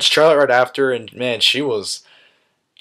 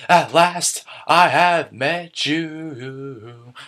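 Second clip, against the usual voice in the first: very slow, higher, theatrical proclamation; hammed-up dramatic line; 4 semitones higher, much slower than usual. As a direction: drag it out like a grand villain. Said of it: "That was lame, I'm sorry".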